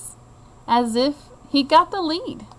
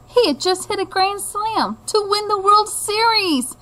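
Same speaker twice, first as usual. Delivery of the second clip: higher, thrilled storytelling; excited; 9 semitones higher, 3 dB louder than usual. A narrator's reading. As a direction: ride the thrill of the win. Dream grand slam moment.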